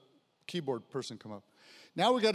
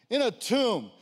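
ordinary talk, nothing marked

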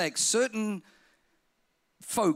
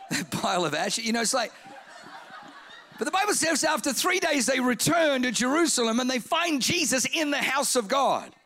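ordinary talk, nothing marked

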